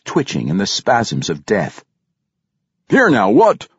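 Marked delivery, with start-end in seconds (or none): 2.9-3.7 much higher, louder call; startled calling out. A narrator's reading. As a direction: shout across the firelight. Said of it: "another driver called".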